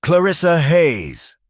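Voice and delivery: high, slow, drawn-out call across the yard. A narrator's reading. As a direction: shout her name from outside. Mob leader calling out her name.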